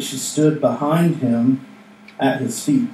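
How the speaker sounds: ordinary talk, nothing marked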